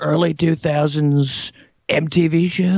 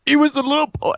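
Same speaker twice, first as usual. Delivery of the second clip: teary; 7 semitones higher, faster than usual. Acted. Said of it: "Don't lose all the crying".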